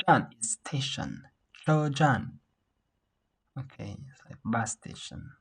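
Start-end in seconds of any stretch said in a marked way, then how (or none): 1.6-2.4 "Cho jan" with high level "Cho", then falling "jan"; first plus fourth tone. Shown as a demonstration. Teacher modelling tone pair, "Cho jan" high then falling. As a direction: hold "Cho" high, drop "jan" steeply. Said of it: "first tone plus fourth tone".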